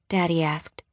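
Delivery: ordinary delivery, nothing marked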